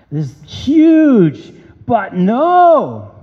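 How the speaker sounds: big slow emphatic proclamation; emphatic build. Acted. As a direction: stretch it out slowly for effect, then let it drop. Preacher hammers home scripture point.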